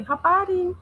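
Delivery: put-on high-pitched Tanzanian accent; Tanzanian greeting. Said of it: "or they'll say".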